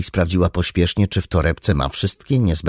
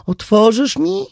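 mother asking; 5 dB louder than usual. Acted. Mother at locked door.

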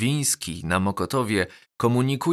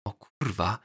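hushed, awed swearing; whispered; 5 dB quieter than usual. A narrator's reading. Schoolboy to his deskmate as the new girl arrives, whispering. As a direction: swear under your breath to a friend. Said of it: "szepnął do mnie Lalu".

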